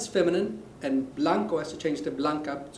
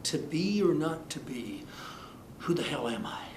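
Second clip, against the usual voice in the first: softer, lower, brooding mock Hamlet; mock philosopher; 3 semitones lower, 7 dB quieter than usual. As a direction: ponder it like a melodramatic philosopher. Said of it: "like philosophy".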